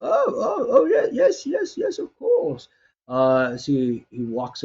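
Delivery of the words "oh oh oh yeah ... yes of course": put-on much higher, excited old cook; delighted old man, squeaky with joy; eager old man.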